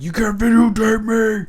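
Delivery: high, loud mock outrage of a rude stranger; angry stranger. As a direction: squawk it like an angry passerby caught on camera. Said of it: "people being like".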